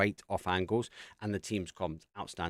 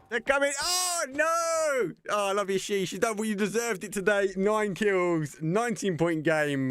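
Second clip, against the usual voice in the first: much higher, louder frantic calls of the win; ecstatic shouting caster; 12 semitones higher, 6 dB louder, slower than usual. Real, not acted. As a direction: scream the final moments like the crowd's on its feet. Caster calls the final fight.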